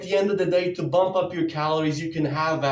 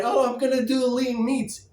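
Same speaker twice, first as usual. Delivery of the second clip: mocking dieter; 7 semitones higher than usual.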